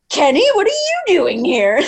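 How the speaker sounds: high mock surprise at an old classmate; surprised. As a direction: act caught off guard, pitch up. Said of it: "I looked back at the window like".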